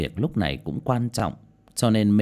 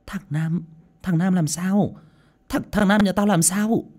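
high, urgent questioning; anxious mother, pitched up and stumbling; hurried, alarmed question; 10 semitones higher, 3 dB louder than usual. A narrator's reading. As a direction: demand news of your boy in rising alarm. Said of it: "liền vội vã hỏi".

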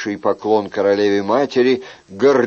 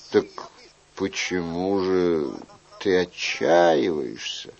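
slow, soft, lower voice; gentle royal questioning; 4 semitones lower, 8 dB quieter, slower than usual. Acted. King asks young man why he despairs, gentle. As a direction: draw the question out softly.

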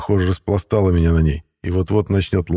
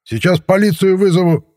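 high, loud shout down from a window; neighbour yelling from above; 12 semitones higher, 5 dB louder than usual. A narrator's reading. Neighbour shouting down from upstairs at the bench. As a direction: holler down at the pair on the bench. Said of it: "раздался голос откуда-то сверху".

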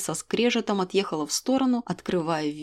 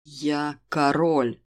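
lower, slow furious declaration; king asserting his rank in anger; ringing with fury; 6 semitones lower, much slower than usual. Acted. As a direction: bite off each word in rage. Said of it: "Голос Даррена звенел от ярости".

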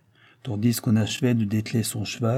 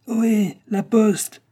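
high, loud hail; calling from outside; 12 semitones higher, 6 dB louder, slower than usual. A narrator's reading. Rider hailing the inn from outside, high loud call. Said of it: "cria-t-on du dehors".

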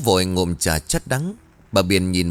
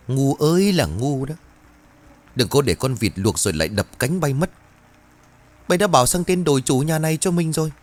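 higher, fast, shouting tirade at her husband; furious roar; 6 semitones higher than usual. Acted. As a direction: berate him like you're fed up for good. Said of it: "gầm lên". (giọng gầm lên giận dữ)

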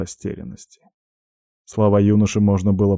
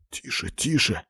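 hushing; 7 dB quieter than usual. Acted. Hushing drunk friend.

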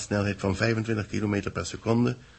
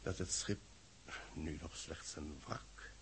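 fading out; 4 semitones lower, 21 dB quieter than usual. Acted. Broadcast fades out.

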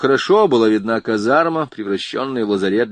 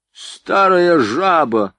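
высокий, растянутый выкрик с обидой; выпалить оскорбление; 6 semitones higher, 4 dB louder, much slower than usual. A narrator's reading. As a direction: insult hurled out, high and drawn out. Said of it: "выпалила Лолочка". (выпалить протяжно и высоко)

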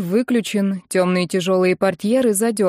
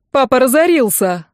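loud, higher cry of bad news; yelling; 4 semitones higher, 5 dB louder than usual. A narrator's reading. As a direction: shout it out in exasperation. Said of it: "заорал Серёжа". (орёт с досадой)

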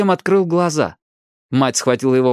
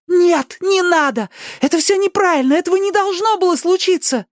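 very high, urgent cries; desperate protest. A narrator's reading. Ali stopping Danny from leaving, desperately protesting. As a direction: plead in rising alarm.

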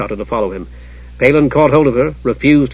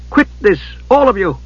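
higher-pitched protest, not shouted; calling out; 7 semitones higher than usual. A narrator's reading. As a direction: call a halt in a raised pitch. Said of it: "called out Usha".

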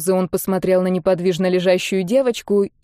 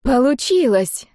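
higher, drawn-out amazement; stunned girl; 5 semitones higher, slower than usual. A narrator's reading. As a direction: marvel in a girlish voice. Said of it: "ошарашенно произнесла девочка". (ошарашенно, по-девичьи)

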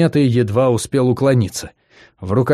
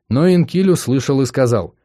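usual speaking voice

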